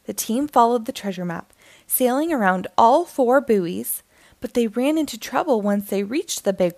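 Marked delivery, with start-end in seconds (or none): none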